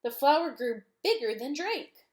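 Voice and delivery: plain reading voice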